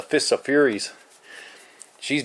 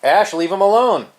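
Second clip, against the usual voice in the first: sharp, higher, louder telling-off; scolding; 7 semitones higher, 5 dB louder than usual. Real, not acted. Owner scolding Ash off Random, loud and high. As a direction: stop the cat in its tracks. Scolding cat attacking another.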